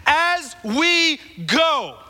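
high, drawn out word by word; emphatic refrain. Real, not acted. Preacher hammering the point to the congregation, emphatic. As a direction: stretch each word out, pitched high and weighty.

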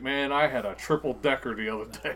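mild mock bragging wrestler; lightly voiced Braun Strowman. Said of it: "He's like".

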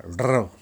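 ロ with a trilled R; rolled R sound. Shown as a demonstration. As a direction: roll the tongue on ロ. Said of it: "これは巻き舌". (巻き舌で「ロ」)

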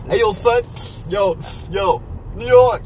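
put-on loud, high New York accent. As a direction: yell it like a New Yorker. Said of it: "let's give him the real".

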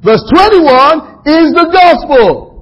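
high, loud, slow preacher's build; preaching at full force. Acted. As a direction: hammer the point home to the congregation.

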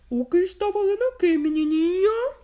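a sly wolf calling out. Acted. Wolf surprising the girl in the forest, loud. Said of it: "perguntou o lobo".

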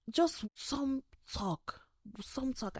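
high, slow sarcastic drawl; mock his smooth talk. Real, not acted. Mocking his lines.

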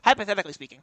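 rapid-fire nasally debater impression; fast, loud mock debater; Ben Shapiro. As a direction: rattle it off like a whiny pundit. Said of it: "goes like this".

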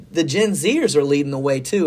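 ordinary talk

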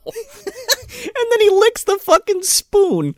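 laughing. Real, not acted. Laughing disgust.

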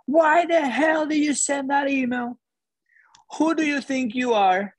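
high, slow mock scream at an employee; retold screaming. Risk officer screaming at the young manager, mock yell. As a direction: shout it like an enraged executive. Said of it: "he starts screaming".

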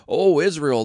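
a crowd hailing its new idol; exclaimed. A narrator's reading. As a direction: call it out in triumph. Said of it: "they exclaimed".

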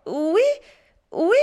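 put-on high, sly child voice; cheeky, knowing yes; mischievous. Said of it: "poursuivit Pierre malicieusement".

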